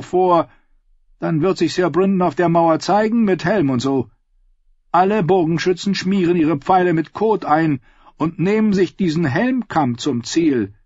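high, loud, sly voice; scheming nephew. Acted. Edwin proposing his plan to the council, sly and high. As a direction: lay out the scheme with relish.

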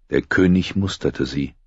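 plain reading voice

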